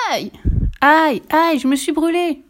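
high, loud, hammed-up burning yelps; mock pain. Fifi mocking Bengt after he grabs her braid, hammed-up high yelps. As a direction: fake being burned for a laugh.

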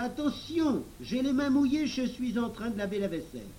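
voix de fillette aiguë, vive et surexcitée; prévenir vivement, très haut perché; s'écrie. Acted. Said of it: "s'écria". (Pony s'écrie, mains mouillées, d'une voix très aiguë)

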